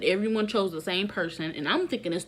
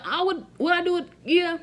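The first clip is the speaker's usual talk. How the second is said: playful; 9 semitones higher, 3 dB louder than usual. Real, not acted. Playful self-question about hooking up.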